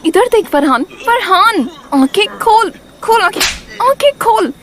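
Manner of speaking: loud, insistent calls to rouse him; urgent alarm. Acted. Woman rousing collapsed friend, urgent alarm. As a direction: sharpen the urgency with each command.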